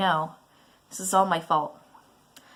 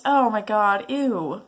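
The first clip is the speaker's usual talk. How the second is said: normal talking voice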